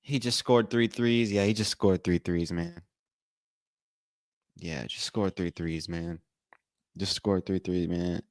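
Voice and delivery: subdued, sinking further with each repeat; downcast. Real, not acted. Fan mourning the injured player's hot start, quietly downcast.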